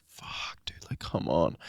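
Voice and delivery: soft frustrated whisper. Retold or imitated. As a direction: grumble it under your breath. Frustrated, no water.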